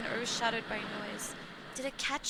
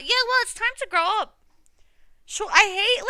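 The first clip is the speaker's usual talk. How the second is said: loud sarcastic scolding; hammed-up grumpy rant; exaggerated mock lecture; 9 semitones higher, 11 dB louder than usual. Real, not acted. Mocking defence of yelling.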